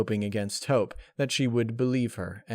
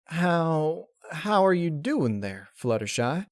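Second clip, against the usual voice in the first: nervous greeting; 6 semitones higher, slower than usual. Acted. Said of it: "hoofed at the ground nervously".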